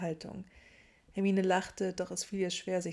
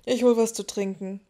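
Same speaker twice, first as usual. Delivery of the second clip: loud; 3 semitones higher, 11 dB louder than usual. A narrator's reading. Ron announcing loudly.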